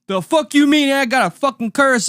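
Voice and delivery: high, loud mock yell of a furious customer; angry customer yell. Telling about a liquor store customer, a mock yell. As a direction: bark it back like an enraged drunk. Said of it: "angry customer retold".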